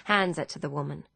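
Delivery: usual speaking voice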